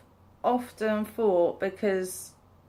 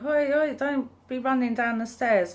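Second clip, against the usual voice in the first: put-on high sharp call of a mum to running kids; mock telling-off; 6 semitones higher than usual. Mum calls after kids running down stairs, high warning.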